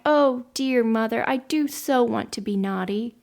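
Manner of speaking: ordinary delivery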